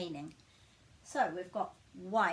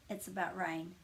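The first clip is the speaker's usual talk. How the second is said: ordinary delivery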